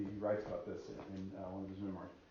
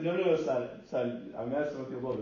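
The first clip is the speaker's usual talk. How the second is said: laughing reassurance; 2 semitones higher, 8 dB louder than usual. Retold or imitated. Reassuring the frightened young man.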